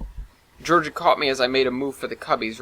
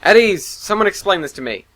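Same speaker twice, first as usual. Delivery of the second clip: commanding; 6 semitones higher, 7 dB louder than usual. A narrator's reading. Admiral enters bridge, commanding.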